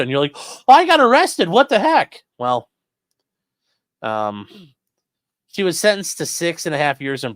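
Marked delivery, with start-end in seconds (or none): 0.4-2.2 high, loud, hammed-up surprise; mock shocked. Streamer mocking the arrested journalist's shock, high and loud. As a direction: squeal it like a clueless tourist caught out.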